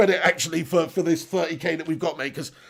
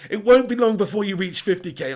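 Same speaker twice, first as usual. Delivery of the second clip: ordinary talk, nothing marked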